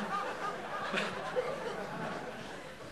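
high breathless silent laugh; wheezy laugh. Real, not acted. Laughs after quote.

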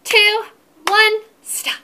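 loud, high drum-roll hype; loud, bouncy countdown to stop the song; excited countdown. Acted. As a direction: pump the kids up for the big stop.

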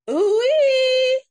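loud, very high held whoop; high "ooh-ee" squeal. Acted. Owl adding to Pig's monster noises, high squeal. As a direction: squeal out a long, piercing ooh-ee.

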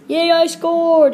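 excited cheer. Pretend. Player celebrating goal.

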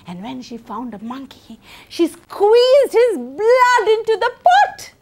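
usual speaking voice

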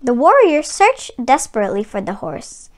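ordinary delivery

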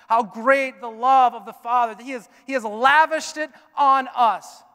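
loud, high, ringing pulpit voice; full-force preaching, high and loud. Acted. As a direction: preach it out over the whole room.

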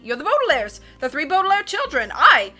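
loud, emphatic captain's chatter; boisterous sea captain, excited. Acted. Captain recognising the three Baudelaires, boisterous and excited. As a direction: charge on with punched exclamations.

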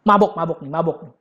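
low, scornful muttered accusation; Eli judging. Acted. Eli eyeing Hana's moving lips, low scornful accusation. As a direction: sneer at her like a suspicious priest.

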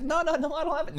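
high, mock-denying "no, no, no"; lightly voiced protesting boy; imitating Okarun. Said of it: "but he's like".

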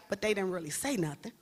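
normal talking voice

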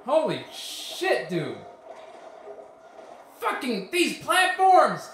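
slow, high, loud yelling; frustrated yelling. Real, not acted. Gamer raging at moving platforms, slow angry yelling.

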